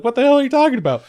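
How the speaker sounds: fast, high-pitched comeback; rapid mock-offended protest; indignant retort. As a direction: act out baffled indignation. Acted quarrel, she answers back.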